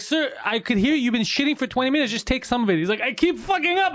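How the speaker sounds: mocking the staff and the stuck guy; mock employee voice. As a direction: ham up the desperate guy. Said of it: "They're like".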